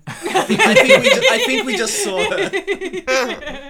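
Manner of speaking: cracking up while answering; laughing hard. Real, not acted. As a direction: fight through a laughing fit. Laughing hard mid-sentence.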